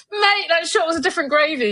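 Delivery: high, laughing South London quip; South London slang. Retold or imitated. She quotes her own casual commentary in a test match. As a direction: toss off a South London expression. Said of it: "South London expressions".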